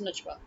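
"Najwa" as nuj-WAH; j sound in the middle of "Najwa"; name with J. Shown as a demonstration. Explaining how Pakistanis say her name, with J. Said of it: "we have J".

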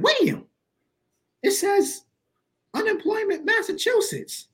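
very high falsetto mom voice; imitated excited mom. Mom reading surprise mail to her son, high imitated mom. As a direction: squeal it out as an astonished mom.

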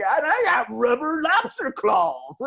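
high, slow, goofy put-on voice; goofy festival guy. Mocking a festival guy in rubber lobster claws, goofy high voice. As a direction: ham up a dopey tourist in lobster claws. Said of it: "there's a guy with, like".

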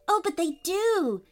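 put-on high-pitched child, eagerly insisting; insistent little girl. A narrator's reading. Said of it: "insisted Poppy".

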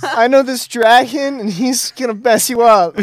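high, loud, playful threat; hammed-up threat. Player threatening the foe with a dragon, hammed-up threat. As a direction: brag loudly about a scary friend.

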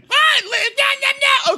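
very high squawking imitation; high-pitched mock outburst; mock shrieking. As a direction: squawk protests in a hammed-up shriek. Mimics Ciara coming in shouting.

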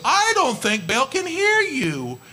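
high, slow mock concern; sarcastic. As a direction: tease them, as if Baal simply can't hear. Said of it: "He was sarcastic".

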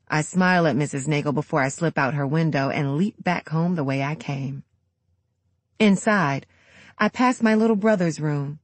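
plain reading voice

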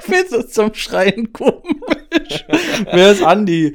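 slow, laughing sarcasm. Real, not acted. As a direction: laugh through the words. Ironisch lachend über eigenen Witz. (lachend ironisch)

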